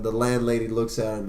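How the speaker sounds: ordinary talk, nothing marked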